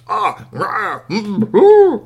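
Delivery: high, loud wordless outburst; growing roar of garbled cursing; shouted angry noises. A narrator's reading. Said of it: "Kwame started shouting things".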